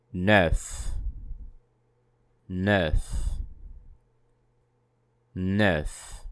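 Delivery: neuf with an open rounded vowel; model word. Shown as a demonstration. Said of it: "the number nine in French is".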